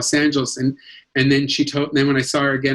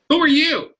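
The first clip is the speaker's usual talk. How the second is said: higher retold demand; movie star's demand; 13 semitones higher, 3 dB louder than usual. Shelley Winters looked at the young actor and asked, imperious imitation. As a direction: imitate a star demanding to know who this is.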